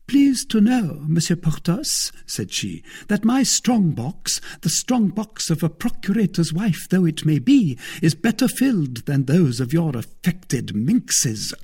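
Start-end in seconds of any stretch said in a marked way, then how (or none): 0.0-2.1 high-pitched, haughty retort; piqued. A narrator's reading. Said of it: "The procurator's wife was piqued". 3.1-11.5 high, offended wife; piqued. A narrator's reading. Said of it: "The procurator's wife was piqued".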